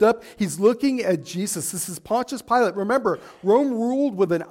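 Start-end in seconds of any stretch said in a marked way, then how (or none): none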